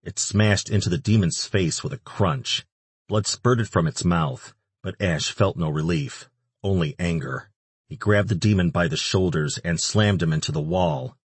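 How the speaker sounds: ordinary delivery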